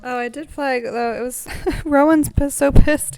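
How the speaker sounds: high, loud burst on so pissed; loud excitement. Real, not acted. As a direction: blurt it out with a laugh. Excited recounting Rowan's anger.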